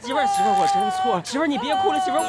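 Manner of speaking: very high, desperate pleading; wailing apology. Acted. Husband begs crying wife to forgive.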